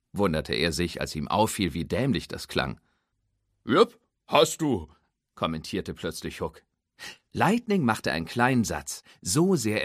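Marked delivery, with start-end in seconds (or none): 3.6-4.9 high, louder, slow drawling tow-truck voice; folksy tow truck. Acted. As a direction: tow truck Hook teasing his friend, high and drawling. Hook's voice.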